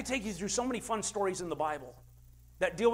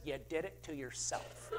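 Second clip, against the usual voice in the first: mock deadpan; 5 semitones lower, 11 dB quieter than usual. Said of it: "mother-in-law looks down and says".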